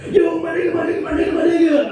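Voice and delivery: Brian shouting. Retold or imitated. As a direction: holler it down the street. Brian yelling on the street.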